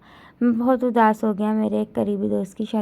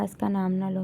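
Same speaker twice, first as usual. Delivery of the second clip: usual speaking voice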